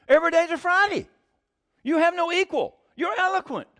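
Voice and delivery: a cheerful mock cheerleader preacher; Joel Osteen. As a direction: parody a sunny TV preacher. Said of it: "this is a Joel Osteen moment".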